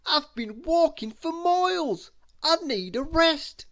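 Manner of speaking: high comic character voice, loud; high, loud comic complaint; Mister Clumsy voice. A narrator's reading. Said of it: "he moaned".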